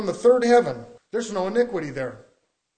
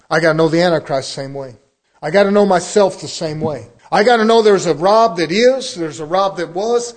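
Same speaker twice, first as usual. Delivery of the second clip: louder, emphatic pulpit declaration; forceful preaching; 8 dB louder than usual. Real, not acted. Preacher to his congregation, loud emphatic declaration. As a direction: hammer each line home.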